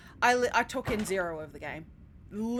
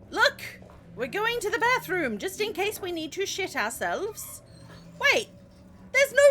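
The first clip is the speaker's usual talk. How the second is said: excited; 8 semitones higher than usual. Real, not acted. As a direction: burst out eagerly. Excited then alarmed in bathroom.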